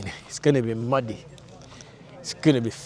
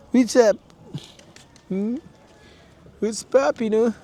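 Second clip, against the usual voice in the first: high-pitched greeting to an animal; pet talk; 8 semitones higher than usual. Real, not acted. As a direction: greet a pet in a raised, playful voice.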